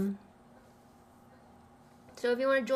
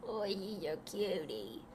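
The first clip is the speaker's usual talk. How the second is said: usual speaking voice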